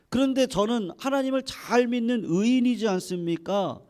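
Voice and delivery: high, insistent protest to God; impassioned complaint. Acted. Job argues his innocence before God, loud resentful complaint. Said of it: "욥이 이렇게 원망을 하는 거예요".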